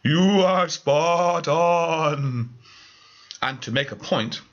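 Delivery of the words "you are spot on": very slow, eerie drawn-out ghost. Acted. Ghost confirming the medium's guess, slow eerie voice. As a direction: stretch each word from beyond the grave.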